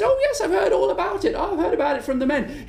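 put-on high, quick old witch. Witch to the worried man, high and quick. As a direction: chirp it out like a knowing old witch. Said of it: "she says".

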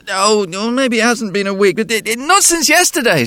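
put-on high, loud child's voice; a small boy answering the policeman; little boy voice. A narrator's reading. Boy's voice in story.

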